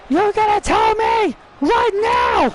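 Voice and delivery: high, furious demand; angry shout. Acted. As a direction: yell it in her face. Demanding answers from Nikki.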